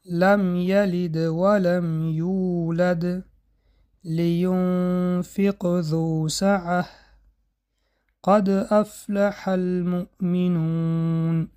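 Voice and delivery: slow, chanted recitation of the verses; slow Quran recitation. Shown as a demonstration. Teacher recites Quran verses for students as examples. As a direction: recite the verses slowly with a light inner qalqala.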